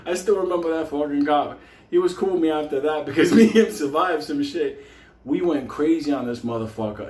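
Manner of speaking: ordinary talk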